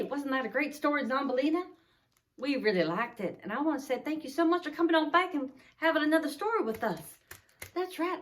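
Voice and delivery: soft, fast chatty aside; quick, quiet host chatter; host banter. Acted. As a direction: rattle it off softly to the puppet. Host talks to character puppet.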